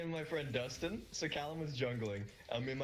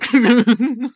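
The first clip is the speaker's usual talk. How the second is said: shrieked, much higher and much louder, hammed-up; mock outrage; 11 semitones higher, 25 dB louder, slower than usual. Friend mocked his country, so he shrieks mock offence. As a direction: play scandalised at the insult.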